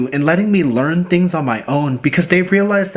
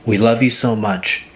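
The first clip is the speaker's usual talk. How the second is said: lower, measured voice with pauses between phrases; heartfelt letter reading; 4 semitones lower than usual. Retold or imitated. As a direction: read a mother's letter aloud, low and unhurried. Reads mother's letter aloud.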